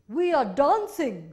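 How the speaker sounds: high, loud, slow little creature voice. Acted. The Minihos answering the boy, high and loud, slow and proud. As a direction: sing it out as a proud tiny sprite. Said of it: "said the Minihos".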